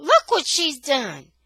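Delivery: put-on boy yelling, pointing out his sister. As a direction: shout it to make Mama look. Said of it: "And I yell".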